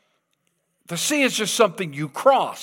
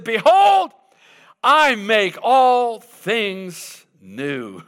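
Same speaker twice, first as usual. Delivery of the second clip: much higher, louder Behold, then slow; voice of God; 4 semitones higher, 3 dB louder, much slower than usual. Acted. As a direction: proclaim it from the throne. God speaking from the throne.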